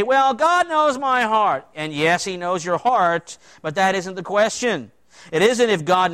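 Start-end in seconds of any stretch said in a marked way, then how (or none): 0.0-1.7 mock excuse. Mocking people's excuse.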